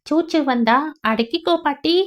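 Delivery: put-on a cheeky kid advising grandma; child's voice. Boy telling grandma to hold it, child's voice. Boy's line.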